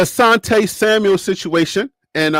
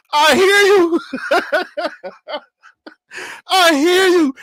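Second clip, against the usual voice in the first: laughing; 10 semitones higher, slower than usual. Real, not acted. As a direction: laugh it off, half crying with laughter. Laughing at critics' insults.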